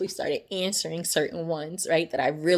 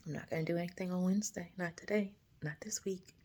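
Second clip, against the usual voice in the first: hushed reply; 13 dB quieter than usual. Retold or imitated. Her quoted reply to God.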